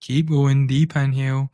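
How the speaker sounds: guided breathwork. Acted. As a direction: lead the listener gently through each breath. Leads a guided breathing session.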